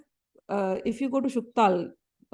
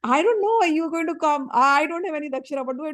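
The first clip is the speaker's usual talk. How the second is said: higher, louder, panicky and at a loss; mock-bewildered pleading, half laughing; flustered disciple; 5 semitones higher, 4 dB louder than usual. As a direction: blurt it out like a man caught with nothing to give.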